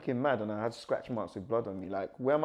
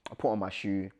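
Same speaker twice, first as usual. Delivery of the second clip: ordinary delivery, nothing marked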